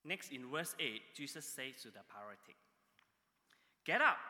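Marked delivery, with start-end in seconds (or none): 3.8-4.2 loud, high-pitched sharp command. Retold or imitated. Jesus orders the paralytic to get up, loud command. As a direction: call it out as an order. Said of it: "When Jesus commanded".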